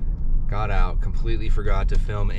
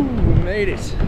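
excited; 7 semitones higher, slower than usual. Real, not acted. Relief after the climb.

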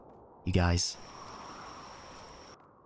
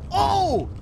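terrified shouting; 9 semitones higher than usual. Real, not acted. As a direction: beg chat for help in panic. Cornered again, calling for help.

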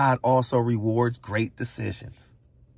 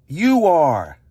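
sharp raised "You are", higher and louder; loud accusation; 6 semitones higher, 7 dB louder than usual. Real, not acted. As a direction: jab the word you at them. Accusing the audience.

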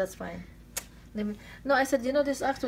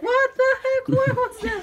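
very high, louder startled squeak voice; squeaky pet voice; 8 dB louder than usual. Acted. Owner voices the startled pet in a very high squeak. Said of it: "Squeaks like".

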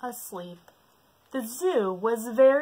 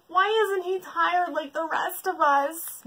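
mild weary moan, higher; 10 semitones higher, 4 dB louder than usual. Acted. Bear moans to the others, mild. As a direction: complain tiredly. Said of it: "moaned the bear".

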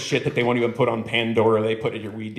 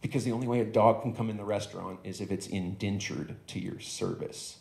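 ordinary delivery

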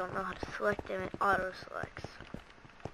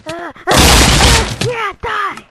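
loud, high attack cry; high shouted grunts into a war cry; battle yell; 12 semitones higher, 19 dB louder, slower than usual. Real, not acted. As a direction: yell it while going for the kill. Attacking an enemy in game.

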